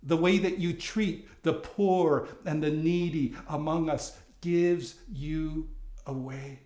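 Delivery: ordinary talk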